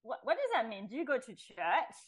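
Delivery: playful needling with a rising right; teasing provocation. Retold or imitated. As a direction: poke at her a little, half joking. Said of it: "I become a little bit provocative".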